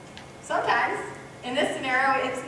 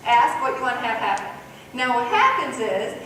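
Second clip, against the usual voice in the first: ordinary talk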